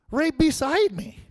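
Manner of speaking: slow, high, incredulous; exasperated disbelief. Retold or imitated. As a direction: roll the eyes at it. Old man sits beside him.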